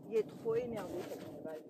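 low put-on voice for the horse; grumpy horse voice.

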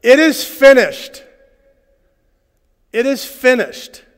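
high, loud and slow cry from the cross; shouted. Retold or imitated. Preacher voicing Jesus on the cross, loud shout. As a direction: proclaim it with full force. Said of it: "he shouted".

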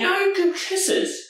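very high cooing to the dog; high cooing pet voice; high dog-talk voice. Real, not acted. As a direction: coo it up high.

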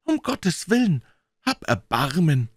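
very high, desperate plea; calling from inside. A narrator's reading. Trapped man begs the lawyer not to break in, desperately pleading. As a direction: beg for mercy in a high wail. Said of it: "rief eine Stimme von innen".

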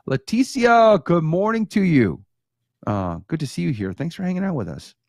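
ordinary talk

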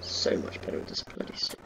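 whispered aside; whispering. Real, not acted. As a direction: whisper it to yourself. Muttered aside.

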